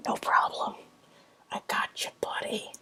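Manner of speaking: whispered character voice, all breath; whispered reply. Acted. Companion answers thanks for coming along, whispered. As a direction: whisper it softly to a friend.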